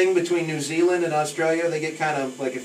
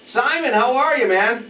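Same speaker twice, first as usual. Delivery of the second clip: loud, higher, friendly phone hello; hearty greeting; 9 semitones higher, 5 dB louder than usual. Real, not acted. DJ calling the hotel clerk live on air, loud hello. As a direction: greet him big over the phone.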